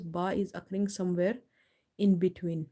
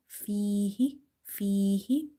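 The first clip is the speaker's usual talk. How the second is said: fihi with a long first ee; stretched vowel; much slower than usual. Shown as a demonstration. Teacher shows reading by stretching, lengthened vowel. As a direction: lengthen the fee of fihi, then a short hi. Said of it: "So read by stretching".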